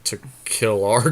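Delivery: ordinary talk, nothing marked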